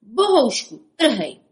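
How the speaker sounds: barked order to the dog, loud; captain's loud order; commanding shout. Acted. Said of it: "přikázal".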